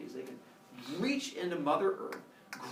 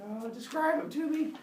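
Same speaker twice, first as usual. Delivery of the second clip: dreading Father Sky; 11 semitones higher than usual. Acted. Father Sky covers eyes, dreading.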